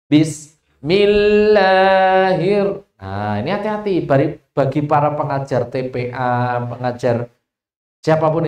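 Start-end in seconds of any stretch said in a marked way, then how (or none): 0.1-2.9 "Bismillahir" chanted very slowly then cut off; broken recitation. Shown as a demonstration. Teacher showing a dictation that chops the basmala. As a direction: start "Bismillahir" slowly and stop mid-word. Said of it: "contoh kayak gini tadi".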